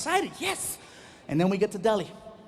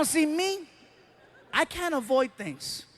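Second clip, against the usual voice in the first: ordinary talk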